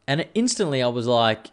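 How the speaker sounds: ordinary delivery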